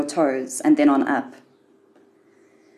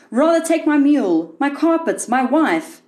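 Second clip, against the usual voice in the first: high, pleading cry of a bargaining man; desperate call; 9 semitones higher than usual. Acted. A frightened man calling out to the afterworld, high and pleading. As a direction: cry out, offering anything to be spared. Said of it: "Some are heard to call out".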